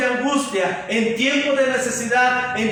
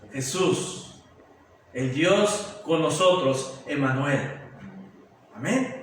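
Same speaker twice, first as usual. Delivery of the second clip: much lower and softer, slowing down; reverent hush; 9 semitones lower, 6 dB quieter, slower than usual. Real, not acted. Reverent naming of Jesus.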